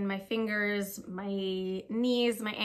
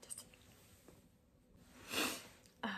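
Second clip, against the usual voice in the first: near-silent whisper, holding back tears; real tearful whisper, voice almost gone; fighting tears; 4 semitones higher, 17 dB quieter, faster than usual. Real, not acted. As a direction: whisper it as if about to cry. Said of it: "trying not to cry".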